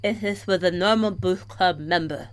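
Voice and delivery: thick-tongued, mushy consonants; swollen tongue. Pretend. Reading a tongue-tied heroine meeting a club member, swollen-tongue speech. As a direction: talk as if your tongue fills your mouth. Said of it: "feel my tongue swelling in my mouth".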